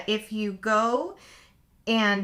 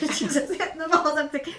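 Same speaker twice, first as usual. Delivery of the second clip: ordinary delivery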